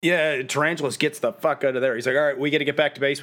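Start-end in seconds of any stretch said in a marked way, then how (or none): 2.1-3.2 hurried. Retold or imitated. Tarantulas fleeing hurriedly.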